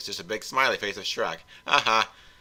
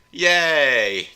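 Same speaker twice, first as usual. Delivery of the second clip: loud, high drawn-out cheer; excited cheer; 6 semitones higher, 9 dB louder than usual. Acted. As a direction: cheer like a delighted kid. Farquaad allowed to stay, cheers.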